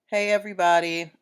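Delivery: normal talking voice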